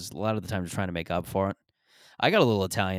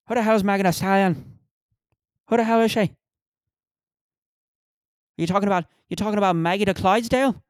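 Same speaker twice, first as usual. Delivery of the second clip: loud, high Irish tough guy; belligerent, hammed-up Irish accent; put-on Irish accent; 11 semitones higher, 6 dB louder than usual. Irish pub tough asking about [name], belligerent Irish accent. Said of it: "That Ir-, that Irish accent".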